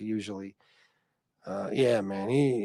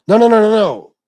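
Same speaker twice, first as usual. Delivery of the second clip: loud denial; 7 semitones higher, 14 dB louder than usual. Real, not acted. Correcting a misunderstanding loudly.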